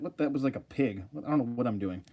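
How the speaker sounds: normal talking voice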